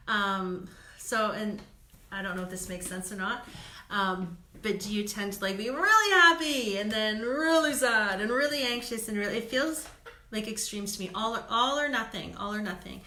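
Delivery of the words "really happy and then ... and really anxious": big swooping pitch, starting high and loud; exaggerated mood swings. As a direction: act out each extreme, sliding up and down. Acts out big emotional swings.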